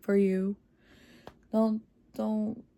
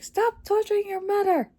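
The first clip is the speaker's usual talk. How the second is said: high, shrill-free mock yell at her daughter, much higher; mock mother's yell; 13 semitones higher, 4 dB louder than usual. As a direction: yell it like an exasperated mom. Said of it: "yelling at Astrid".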